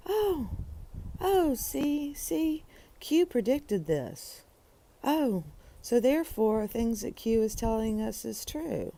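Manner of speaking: high, mocking gullible-believer voice. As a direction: parody the Q faithful going oh, see. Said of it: "But instead".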